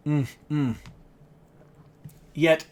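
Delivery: usual speaking voice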